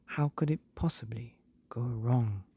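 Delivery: plain reading voice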